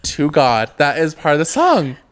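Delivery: loud protest. Real, not acted. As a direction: protest it loudly. Defending her singing, heated.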